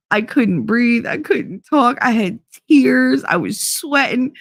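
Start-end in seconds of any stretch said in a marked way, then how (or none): none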